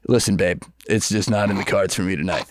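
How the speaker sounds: low-key would-be smooth brush-off; trying to be cool. Retold or imitated. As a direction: play it a little too cool. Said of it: "trying to be so cool".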